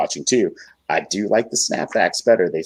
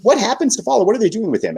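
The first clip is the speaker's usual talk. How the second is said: retold bewilderment, pitched way up; alarmed disbelief. Retelling his reaction to the match's end, alarmed. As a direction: act out a high, baffled fan reaction.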